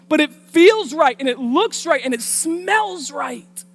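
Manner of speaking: high, loud whine of temptation; protest, stretching the last phrase out; mock pleading justification. Acted excuse of overwhelmed senses.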